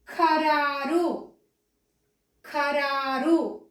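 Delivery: ఖరారు very slowly, puff of air on ఖ; aspirated, drawn out. Shown as a demonstration. Teacher dictating to children for spelling, slow breath-led ఖరారు. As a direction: stretch the long raa in ఖరారు. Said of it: "పలికేటప్పుడు స్పష్టంగా పలికితేనే".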